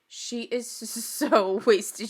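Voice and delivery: slow, drawn-out words with a laugh; real laugh inside a dragged-out remark; amused emphasis. Real, not acted. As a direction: stretch it out while chuckling. Amused at drunk Whitney.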